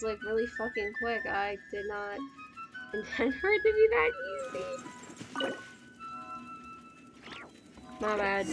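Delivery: normal talking voice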